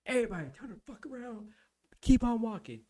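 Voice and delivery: high, forceful drill-instructor command; imitated instructor order. Instructor ordering the flight to keep moving, shouted command.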